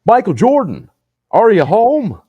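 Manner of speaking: very high, loud, silly celebrity impression. Mimicking a celebrity calling into a shoe, high silly holler. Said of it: "he does that stupid fucking voice".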